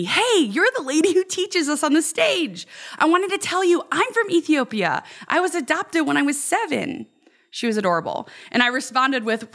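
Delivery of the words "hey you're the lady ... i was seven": put-on high, eager kid voice, talking fast; a thrilled kid stopping the speaker at camp; excited middle schooler. Middle schooler eagerly telling the camp speaker, high and excited. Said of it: "excitedly said to me".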